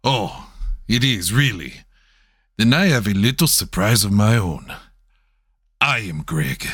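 low, badly faked Mexican accent; terrible Mexican accent. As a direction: make a mock-dramatic reveal in a bad Mexican accent. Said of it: "meant to be a terrible Mexican accent".

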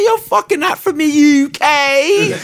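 high, loud, slowed mock outrage; mocking disbelief. Calling out fake British rappers, high mock outrage. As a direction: squeal it out in disbelief. Retelling his reaction to fakers.

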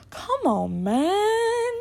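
drawn-out, high-swooping exasperated cry; funny catchphrase. Retold or imitated. Podcaster retelling a family catchphrase said in public, funny high whine. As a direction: stretch it out, leaping way up high. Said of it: "it just sounds funny".

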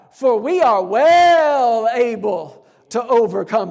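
"well" stretched out long and loud; triumphant, with a drawn-out, swelling "well"; emphatic confidence. Acted. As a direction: declare it slowly, leaning hard on "well". Preacher voices Caleb's confident answer.

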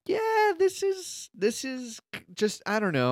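high, slow dazed voice; awestruck. Retold or imitated. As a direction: say it like you're stunned by a corny scene. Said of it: "and I'm like".

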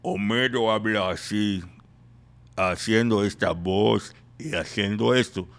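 slow, drawn-out cartoon dad voice; Homero Simpson. Shown as a demonstration. Comedian demonstrating the cartoon voice technique, slow character voice. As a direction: stretch every word in a dopey put-on voice. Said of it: "Homero Simpson".

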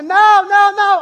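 put-on loud, high panicked child wail; imitating toddler freaking out. Daughter refusing Santa, imitated panicked screaming. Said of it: "totally starts freaking out".

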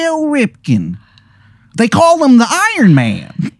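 squeaky-high Baltimore character voice; bad Baltimore accent. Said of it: "this Baltimore accent".

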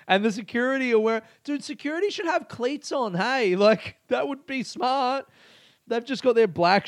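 high, excited giggly telling; can't believe how silly it is; gleeful incredulous storytelling; amused disbelief. Real, not acted. Laughing at clumsy security.

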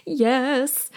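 high, small mock-tearful answer; imitated tearful yes. Crying daughter answers her mother, tearfully imitated. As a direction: imitate a sniffly girl agreeing. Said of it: "nodded and, s-, you know, wiped her snot".

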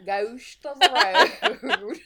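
slow drawled cowboy accent. Shown as a demonstration. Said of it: "like, a cowboy accent".

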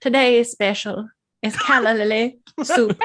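put-on lightly done old Hollywood waitress; a hint of vintage film-star lilt; Katharine Hepburn voice. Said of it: "I would do the waitress".

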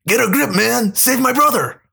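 high, urgent retake shouts; frantic shouting. Acted. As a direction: yell it over the chaos. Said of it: "go ahead".

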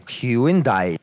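Hyundai as HYU-in-DYE, drawn out in three parts. Shown as a demonstration. Caller plays his version to the helper, Hyundai stretched to hyu-in-dye. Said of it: "'Cause we've been saying".

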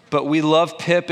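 ordinary delivery

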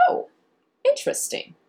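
high, breathy perked-up oh interesting; a light mock perk of curiosity. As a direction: sound suddenly intrigued. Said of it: "I may perk up a little bit".

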